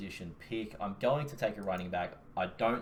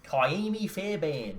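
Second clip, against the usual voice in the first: high, very slow Australian Kaimi Fairbairn; exaggerated Australian vowels, r's dropped in Fairbairn; Australian accent; 7 semitones higher, much slower than usual. Shown as a demonstration. Showing the co-host how disgusting it sounds in an Australian accent. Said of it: "try to say that with an Australian accent".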